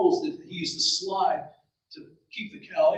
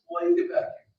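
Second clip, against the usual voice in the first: lightly acted dad's reprimand, pitched up; a hint of stern parent; scolding father; 12 semitones higher than usual. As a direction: call the boy back with a high-pitched scolding. Father scolds his son.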